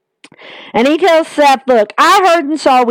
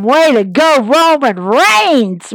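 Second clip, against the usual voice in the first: ordinary talk, nothing marked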